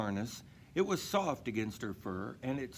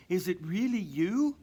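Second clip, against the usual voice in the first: louder, astonished question; can't believe her eyes; amazed Mama; 7 semitones higher, 6 dB louder than usual. Acted. Mama seeing Dasher return, astonished. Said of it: "she said".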